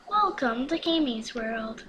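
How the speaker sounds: normal talking voice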